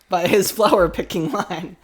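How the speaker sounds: usual speaking voice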